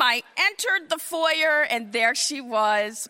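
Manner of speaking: ordinary talk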